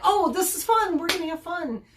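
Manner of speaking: high, bouncy voice for the eager dog; happy dog voice. Retold or imitated. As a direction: voice the dog suddenly thrilled to play. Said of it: "the dog immediately went".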